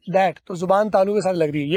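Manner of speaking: ordinary talk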